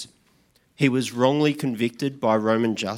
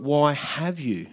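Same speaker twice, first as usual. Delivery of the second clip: slow, weighty "Why have you?" with WHY leaned on; a pointed, slowed question, "Why have you?"; stress on why; 2 semitones higher, slower than usual. Shown as a demonstration.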